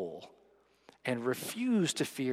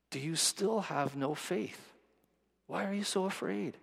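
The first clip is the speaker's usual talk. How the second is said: tender, searching Jesus; 5 semitones higher, 4 dB quieter than usual. Retold or imitated. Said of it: "Jesus says to his disciples".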